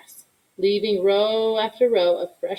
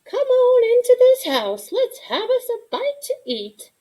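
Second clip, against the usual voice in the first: much higher grandma voice calling from the porch; 4 dB louder than usual. Acted. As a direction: call the child in, like a loving grandmother. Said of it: "Mama called out".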